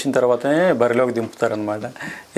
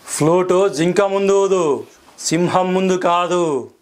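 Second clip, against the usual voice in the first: angry dialogue; 5 semitones higher, much slower than usual. Shown as a demonstration. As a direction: deliver it slowly like a furious film hero. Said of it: "okay, కోపం".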